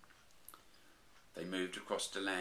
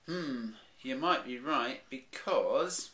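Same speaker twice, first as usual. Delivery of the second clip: higher, slow motherly bear; lightly voiced mum bear musing; Mummy Bear voice; 6 semitones higher, 7 dB louder, slower than usual. Mummy Bear answering Daddy Bear, lightly voiced and musing.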